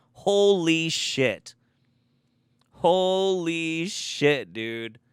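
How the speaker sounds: very slow, drawn-out holy shit; stunned disbelief. Real, not acted. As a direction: let the shock stretch each word. Stunned reaction to citation.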